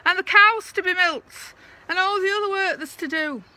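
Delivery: high, loud call across the yard; calling from the wall. Acted. Mary Ann calling the men home from the wall, high and loud. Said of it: "she calls from the top of the haggard wall".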